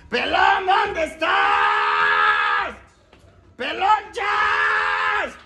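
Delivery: high, slow drawn-out shouts; calling out. Real, not acted. Calling a friend to come down, slow shouts. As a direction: stretch the words up the stairs.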